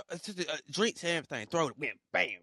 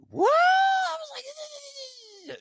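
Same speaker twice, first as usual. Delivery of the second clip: shocked outcry; 5 dB louder, much slower than usual. Retold or imitated. His shocked reaction, retold.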